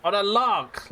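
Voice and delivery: mock show host. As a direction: announce it like a showman. Announcing a show segment.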